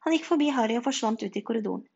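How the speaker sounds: ordinary delivery, nothing marked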